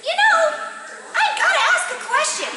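high, bright-eyed little bunny, pleased with itself; tiny bunny voice. Acted. Little bunny Sword answering Kristoff's riddle, squeaky and proud.

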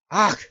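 loud, hissing creature yelp; sharp creature cry of pain. A narrator's reading. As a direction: recoil with a harsh gasp. Gollum hurt by the names.

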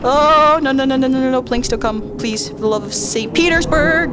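high, loud desperate no-nos; panicked pleading. Real, not acted. Terrified player begging the monster to leave, loud panic. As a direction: beg it to go away in full panic.

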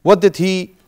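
usual speaking voice

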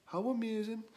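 sarcastic. Acted. Donkey's dry sarcasm.

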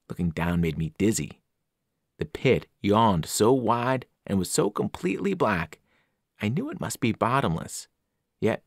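ordinary delivery, nothing marked

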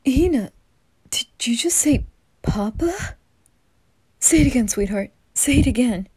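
thrilled dad breaking his hush; excited dad. Acted. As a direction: burst with joy at her first word. Dad overjoyed at first word.

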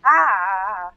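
short loud startled cry; startled yelp. Real, not acted. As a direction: jump at a sudden noise. Startled by fox.

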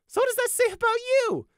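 very high, loud gleeful jab; triumphant high-pitched comeback; grinning retort. A narrator's reading. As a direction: crow it with a big grin. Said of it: "Prey retorted with a grin".

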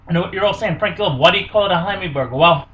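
ordinary talk, nothing marked

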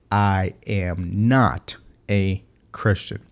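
very slow, every word set apart; slow, spaced-out quoting; deliberate word-by-word quote. Retold or imitated. Spells out the disputed quote.

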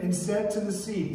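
normal talking voice

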